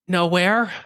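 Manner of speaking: high, loud, faux-innocent "Nowhere"; mock innocent reply to a stranger; playing innocent. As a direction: pitch it up like you have nothing to hide. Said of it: "what anybody would say".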